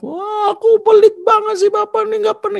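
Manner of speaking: rengekan sangat tinggi seperti anak kecil; anak merengek. Retold or imitated. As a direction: tiru anak kecil yang ngamuk. Anak mengamuk menuduh bapak pelit. (rengekan anak kecil, sangat tinggi)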